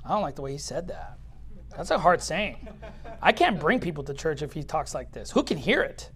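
low, soft grumbling; imitated murmuring, quiet and put out; murmured complaint. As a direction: complain under your breath like a doubting follower. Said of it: "his disciples murmured at it".

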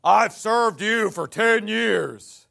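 slow, loud hammed-up grievance; a mocking, drawn-out loud quote; mock complaint. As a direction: drag out the whine of a self-pitying church member.

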